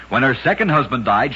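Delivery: ordinary delivery, nothing marked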